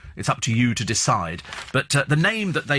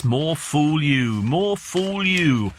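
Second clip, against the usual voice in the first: mocking drawl; much slower than usual. Mocking the gullible reader.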